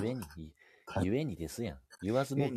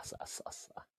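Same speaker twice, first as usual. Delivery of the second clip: whisper; 22 dB quieter than usual. Pretend. Whispered echo after Simpsons.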